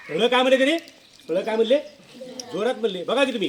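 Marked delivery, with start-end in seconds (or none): none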